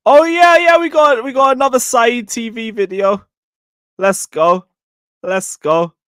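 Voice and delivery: high-pitched burst of glee; excited. Real, not acted. Fan finds another video to play, excited. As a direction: crow with delight at a lucky find.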